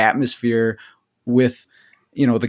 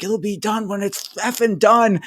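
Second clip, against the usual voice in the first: higher, curt irritated snap; higher snappish retort; mock snapped retort; 8 semitones higher than usual. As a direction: fire it back at the manager. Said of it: "I was like".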